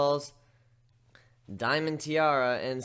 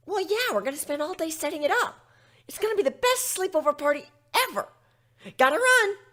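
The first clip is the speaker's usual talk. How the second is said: much higher hurried filly voice; Scootaloo voice; 7 dB louder than usual. Acted. Scootaloo realizing she is late, high and hurried. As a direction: blurt it like a panicked kid.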